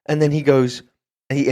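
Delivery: ordinary talk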